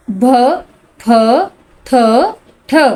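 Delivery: भ, फ, थ, ठ each said as one breathy aspirated sound; heavy letter sounds. Shown as a demonstration. Teacher models भ फ थ ठ for students, aspirated. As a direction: sound out भ फ थ ठ slowly, one at a time. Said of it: "आपने इनको ऐसे ही पढ़ना है".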